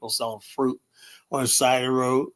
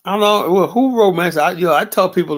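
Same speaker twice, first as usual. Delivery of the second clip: higher and louder, a sudden exasperated burst; loud outburst; 6 semitones higher, 8 dB louder than usual. Real, not acted. Streamer loudly reacting to a viewer's comment, exasperated outburst. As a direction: blurt it out loud at the chat.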